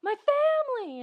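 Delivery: high, slow, loud mock anguish; hammed-up wail. Luke wailing over his burned-up family, mock anguish. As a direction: wail it like a melodramatic hero.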